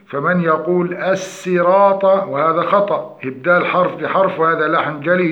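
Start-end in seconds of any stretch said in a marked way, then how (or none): 0.9-2.0 "الصراط" begun with a thin s instead of emphatic sad; sad turned to seen. Shown as a demonstration. Teacher imitates a common error, thin s in الصراط. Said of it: "قلب الصاد إلى سين".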